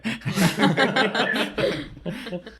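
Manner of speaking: short high laugh that breaks out; high burst of laughter. Real, not acted. Laughing at his own story about the wife's call.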